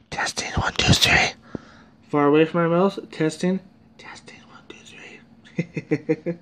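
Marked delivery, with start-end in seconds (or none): 0.1-1.3 voiceless whisper, close to the mic; whispered mic test. Shown as a demonstration. 4.0-6.3 very soft, voiceless whisper; whispered mic test. Shown as a demonstration. Testing the mic far away.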